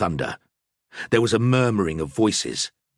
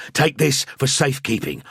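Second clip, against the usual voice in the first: louder, higher, pressing urgency; urgent priest; 7 semitones higher, 3 dB louder than usual. A narrator's reading. Priest pulling his friend away, urgent and louder. Hands pouch to Jakob.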